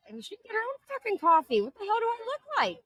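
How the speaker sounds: high, indignant mock rant; indignant sass. Retelling her coffee retort, high indignant sass. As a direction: toss it off, offended and sassy. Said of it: "I'm like".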